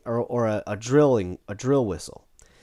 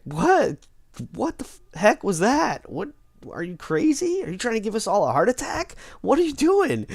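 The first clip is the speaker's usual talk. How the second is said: much higher, rapid-fire panicked questions; freaked-out disbelief; 9 semitones higher than usual. Retold or imitated. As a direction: squeal it like you can't believe what you just saw. Jermaine reacts to the chaos.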